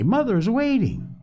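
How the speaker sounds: high-pitched coaxing plea; imploring. A narrator's reading. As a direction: urge with a high, pleading lilt. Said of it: "implored Polly".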